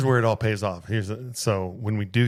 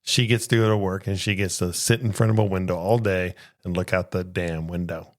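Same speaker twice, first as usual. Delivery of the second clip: ordinary delivery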